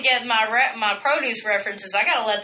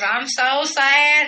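put-on slow, louder drunk wail; mock drunk whine; 5 semitones higher, 5 dB louder, much slower than usual. Retold drunk lament.

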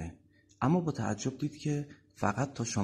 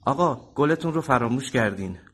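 loud, raised call to a passenger; higher, louder, urgent-to-be-heard shout; 4 semitones higher, 8 dB louder than usual. Acted. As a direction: call after someone who left something behind. Driver calls after departing passenger.

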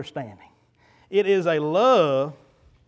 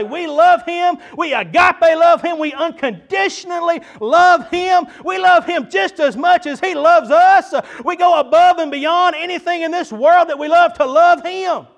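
high, loud, slow proclamation; shouted declaration; 12 semitones higher, 10 dB louder, slower than usual. Acted. Pastor leading church in shouted praise. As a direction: shout it out to the rafters.

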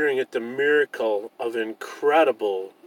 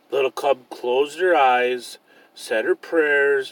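ordinary delivery, nothing marked

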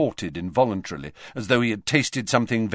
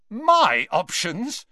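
much higher, louder, slow; loudly; 12 semitones higher, 5 dB louder, slower than usual. A narrator's reading. Malfoy retorting to the headmaster, loud and high. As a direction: fling the words back at him. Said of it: "said Malfoy loudly".

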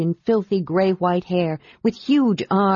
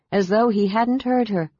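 usual speaking voice

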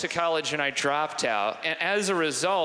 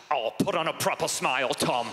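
lightly voiced annoyed British father; a hint of an exasperated British dad; mad British dad. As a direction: scold mildly with a British accent. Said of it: "brief impression of my dad".

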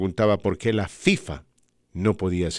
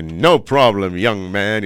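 announcer voice; 8 dB louder, much slower than usual. Retold or imitated. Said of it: "y me dice".

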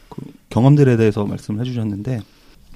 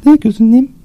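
much higher, louder nurse reply; bright eager head nurse; 13 semitones higher, 8 dB louder than usual. Acted. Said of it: "수간호사 역할 하겠습니다".

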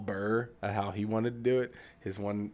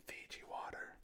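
whispering; 19 dB quieter than usual. Real, not acted. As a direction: whisper "Fiji water" as an aside. Joke aside after cake.

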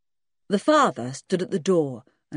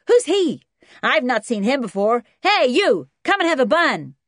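put-on high, loud kid calling out; brash American boy; 8 semitones higher, 8 dB louder than usual. A narrator's reading. Junior inviting the dog for a bun, brash and loud. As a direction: holler to the dog like a cocky boy.

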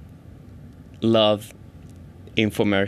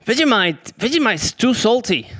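a light high naive voice, pitch raised; mock naive self; 9 semitones higher than usual. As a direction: play your own clueless self. Acted chat with Australian mentor.

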